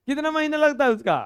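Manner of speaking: loud mock yell; shouting girl. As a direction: yell it like a fierce little girl. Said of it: "वो एकदम चिल्ला के बोल रही थी".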